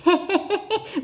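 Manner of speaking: high, jeering cackle; mocking laugh. Bully jeering at someone's looks, high mocking laugh. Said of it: "कहने का तरीका क्या है".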